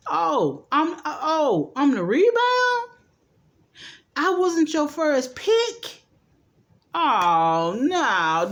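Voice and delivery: high, rising outrage at being second choice; indignant shock. Retold or imitated. A woman stunned to learn she was the rebound, high, indignant shock. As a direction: squeal out the hurt disbelief, voice climbing.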